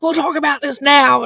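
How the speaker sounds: retold yell. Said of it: "I yelled and I slammed".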